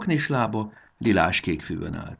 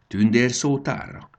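slow, louder, higher echo; puzzled surprise; 5 semitones higher, 6 dB louder than usual. A narrator's reading. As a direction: draw the word out in wonder. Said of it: "csodálkozott Fanni". (csodálkozó, elnyújtott visszakérdezés)

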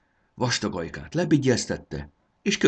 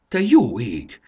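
loud shocked exclamation; stunned cry at the fever; alarmed gasp; 5 semitones higher, 9 dB louder, slower than usual. A narrator's reading. Marci reads the high fever.

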